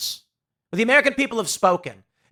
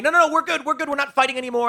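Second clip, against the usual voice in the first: much higher, fast flustered protest; mock hasty backpedal; 8 semitones higher, much faster than usual. Zelensky and Putin backing down, higher flustered mock. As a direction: hurriedly insist everything's fine.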